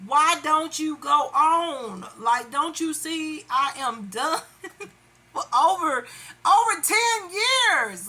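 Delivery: fed up, pitch climbing, words stretched; exasperated pleading. Retold or imitated. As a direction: plead at the end of your rope. Exasperated appeal to the unwanted pursuer.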